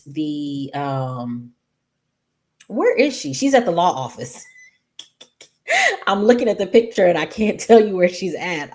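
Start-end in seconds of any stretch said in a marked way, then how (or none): 4.5-8.7 louder, higher, quick laughing confession; laughing at herself. Real, not acted. Host to viewers after losing her place, laughing at herself. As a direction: rattle it out through a laugh.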